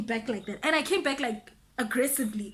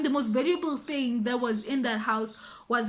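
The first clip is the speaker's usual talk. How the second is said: ordinary delivery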